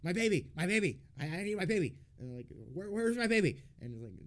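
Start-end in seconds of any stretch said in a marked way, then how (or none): 0.0-2.0 high, loud imitated lament; imitating a distressed patient, pitched high and loud; mock wailing. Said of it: "And she's like". 2.7-3.6 high, loud frantic plea; the same imitated patient, frantic and loud; mock wailing. Retold patient calling for baby.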